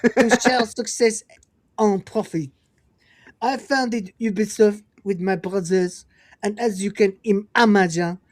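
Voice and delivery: put-on laughing through a French accent. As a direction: read it in a hammy French accent. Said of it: "I'll read them in a French accent".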